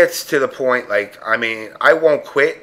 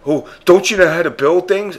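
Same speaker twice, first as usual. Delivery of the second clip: higher, louder condescending mock; mock nosy player; 6 semitones higher, 4 dB louder than usual. Nosy player scolding his build, mimicked high and louder. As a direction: sneer it like a know-it-all stranger. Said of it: "I get these people like".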